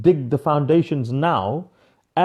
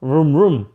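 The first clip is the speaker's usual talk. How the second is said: playful revving engine noise, louder; 4 dB louder than usual. Real, not acted. Coach shows her excitement with a playful vroom. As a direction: rev it up like a car. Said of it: "I just get excited".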